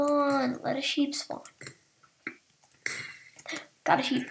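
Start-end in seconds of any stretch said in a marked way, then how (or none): none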